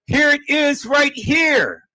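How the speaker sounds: emphatic shout. Real, not acted. Showing proof to viewers.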